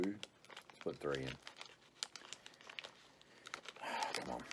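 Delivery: ordinary talk, nothing marked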